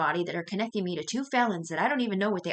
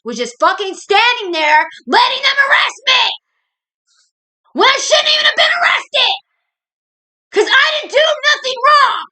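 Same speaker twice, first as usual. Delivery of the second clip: high screaming rant, sobbing through the words; all-out tearful yelling at those who wronged her; crying furious shout; 12 dB louder than usual. Real, not acted. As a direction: scream it out in real tearful rage, pitch way up. Furious breakdown at mom, police.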